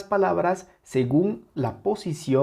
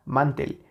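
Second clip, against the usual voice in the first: "mantel" stressed on "man", weak "tel"; sin fuerza en la última. Shown as a demonstration. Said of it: "Si le quitamos esa intensidad". ("mantel" con el golpe en "man")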